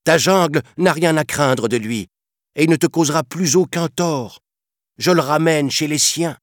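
high, insistent protest; panther crying out. A narrator's reading. Panther defending her protégé to the elephant, insistent cry. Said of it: "cria la panthère".

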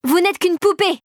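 high, scornful cry; defiant yell. A narrator's reading. Clara insults Mère Gingembre, defiant high yell. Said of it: "hurla celle-ci".

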